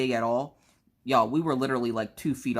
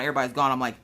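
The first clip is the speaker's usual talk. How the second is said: ordinary delivery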